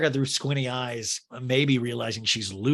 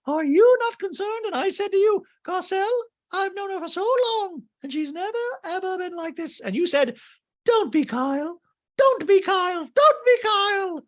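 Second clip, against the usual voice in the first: very high, loud squeak; hammed-up Dorit, squeaky and loud; mock Dorit; 5 dB louder than usual. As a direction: shriek it in a high-pitched squeal. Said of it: "And Dorit goes".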